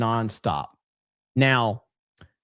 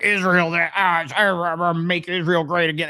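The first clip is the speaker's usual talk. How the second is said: higher, halting mock voice of a ranting fan; mocking a rambling fan; 4 semitones higher than usual. Streamer imitating a chat guy ranting, mock stammer. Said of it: "Oh, he was like".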